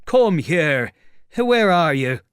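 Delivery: high, loud demand; angry challenge. Acted. Tramp to the unseen voice, angry challenge. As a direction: call out the hidden speaker, high and loud.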